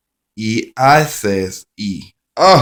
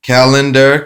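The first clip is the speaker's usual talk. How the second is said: Calendar called out higher and louder; called-out keyword; 4 semitones higher, 6 dB louder than usual. Shown as a demonstration. Teacher flipping the phonics card, Calendar called out loud and high. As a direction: announce the card word Calendar loudly and high. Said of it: "phonics card keyword drill".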